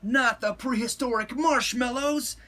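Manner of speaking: loud, high alarmed protest; exclaimed. A narrator's reading. Skipito objects to the Chihuahuas, exclaiming loudly. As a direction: exclaim it with pitch way up. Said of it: "exclaimed Skipito".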